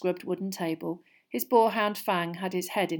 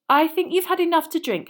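higher, louder firm rebuke; 10 semitones higher, 8 dB louder than usual. A narrator's reading. Hermione cuts off Hagrid's drinking, firm. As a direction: put your foot down, pitch raised. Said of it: "said Hermione firmly".

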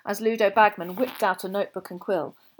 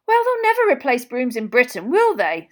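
high, loud, confident voice; jovial official; 6 semitones higher, 9 dB louder than usual. A narrator's reading. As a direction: boom it out, sure of agreement. Bagman's loud jovial character voice.

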